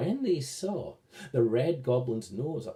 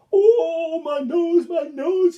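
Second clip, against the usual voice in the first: pained wail; 14 semitones higher, 8 dB louder, slower than usual. Acted. Red goblin's nose being bashed.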